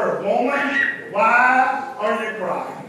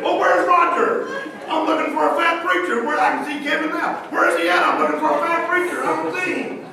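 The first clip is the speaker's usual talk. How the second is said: mock confused seeker; 6 semitones higher than usual. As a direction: ham up a churchgoer hunting for him. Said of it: "walking around saying".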